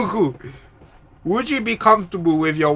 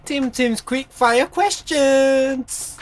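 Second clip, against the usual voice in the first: slow, high game show announcement; announcer voice; 8 semitones higher, much slower than usual. Pretend. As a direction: draw it out like a host. Announcing a podcast segment.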